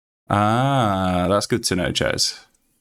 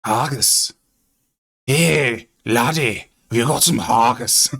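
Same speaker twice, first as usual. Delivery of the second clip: Scottish accent; 3 semitones higher than usual. Shown as a demonstration. Streamer demoing a Scottish accent to chat. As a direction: ham up the Scottish. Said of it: "Oh, Scottish".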